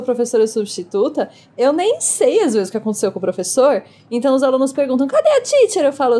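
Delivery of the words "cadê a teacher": high, whiny mock pupil voice; pitched way up like a clamouring pupil; pupils' questioning. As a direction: imitate kids calling for their teacher. Acts pupils asking for their teacher.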